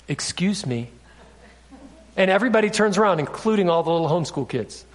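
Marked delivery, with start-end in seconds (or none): 0.1-0.9 low, softer throat-clear and "excuse me"; polite attention-getter. Shown as a demonstration. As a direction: politely ask for the room's attention, quietly. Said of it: "This is how you quiet somebody in America".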